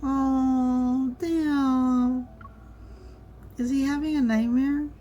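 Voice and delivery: startled, worried oh damn; surprised concern. Real, not acted. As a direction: gasp at the sight of him sweating. Reacting to sweating character.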